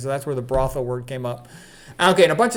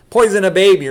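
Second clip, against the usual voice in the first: loud, higher-pitched quoted outburst; retold amused exclamation; 4 semitones higher, 9 dB louder than usual. Quoting the student overheard laughing at the book, exclaiming. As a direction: blurt it out loudly. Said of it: "and he's laughing".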